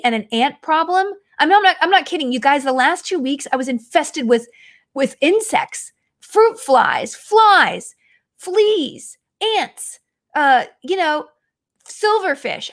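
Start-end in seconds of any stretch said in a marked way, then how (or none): none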